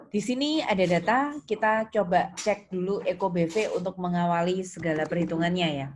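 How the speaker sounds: ordinary talk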